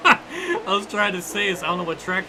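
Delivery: giggling, overwhelmed; real laughter; laughing. Real, not acted.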